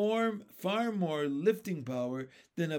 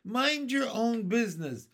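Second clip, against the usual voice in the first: snippy high retort; centipede retort; 7 semitones higher, 5 dB louder than usual. Acted. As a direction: snap it back at them. Centipede snapping back.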